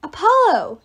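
high, loud call; yelled. A narrator's reading. Meg to collapsed Apollo mid-battle, high yell. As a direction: call out sharply across a fight. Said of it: "yelled Meg".

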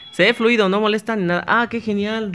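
light up at the good news; thrilled that the stream runs smoothly; delighted. Real, not acted.

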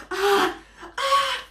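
high pre-sneeze gasps; high rising sneeze wind-up. Acted. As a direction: gasp high as a sneeze builds. Elephant winds up a sneeze.

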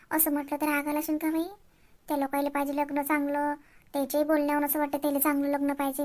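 soft worried muttering to herself; quiet self-talk. Acted. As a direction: fret quietly, half under the breath. Older sister muttering to herself.

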